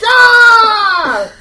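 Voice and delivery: high, loud yell; acted scream. Game master voices the pan attack, high acted scream. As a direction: let out a full-throated "Ah!".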